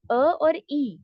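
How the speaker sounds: ا as open "a", ای as long "ee"; two vowel endpoints. Shown as a demonstration. Teacher showing students the two outer vowels, demonstrated apart. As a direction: set ا apart from a stretched ای. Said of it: "ان دونوں کے درمیان ہے".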